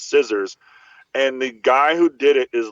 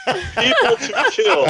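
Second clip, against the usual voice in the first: laughing; much slower than usual. Retold or imitated. Laughing while quoting the list.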